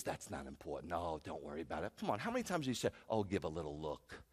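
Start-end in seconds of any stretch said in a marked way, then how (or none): 0.0-1.9 low, soft tempter's voice; hushed, low coaxing; cajoling enemy. Acted. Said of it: "It's the enemy who says". 3.1-4.2 low, soft, coaxing tempter; hushed voice of temptation; tempting enemy. Acted. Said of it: "that's how the enemy talks".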